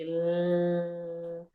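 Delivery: L held long as a lateral hum, no vowel; sustained L sound. Shown as a demonstration. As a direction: hold the l sound out. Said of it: "Qual o som do L?".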